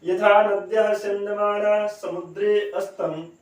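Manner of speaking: measured chanting of the line; slow verse recitation. Shown as a demonstration. Teacher recites again for students, slow chant. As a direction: recite it slowly, word by word. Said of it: "अब आप आसानी से पढ़ पाइएगा इसको".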